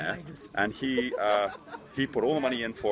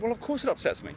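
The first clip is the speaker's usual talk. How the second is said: defensive; 12 semitones higher than usual. Retold or imitated. Defending himself to his sister.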